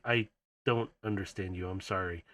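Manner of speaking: low, hushed apology; soft apology. Acted. Kiara to a beeping little bot, softly apologetic.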